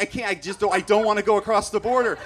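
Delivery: high, loud, stumbling protest; acted-out desperate pleading; panicked refusal. Driver refusing border patrol's order, panicked.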